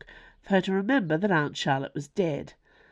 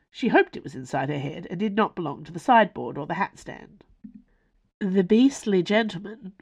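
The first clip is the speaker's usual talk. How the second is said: usual speaking voice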